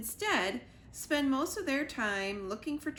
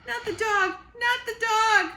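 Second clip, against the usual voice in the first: terrified yell; 12 semitones higher, 11 dB louder than usual. Acted. Squirrel yelling at Sugar in terror. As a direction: scream it in horror. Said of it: "yelled the squirrel".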